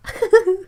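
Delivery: bright high giggle; high laugh. Real, not acted. Guessing place names, breaking into a high laugh. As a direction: laugh at your own guess.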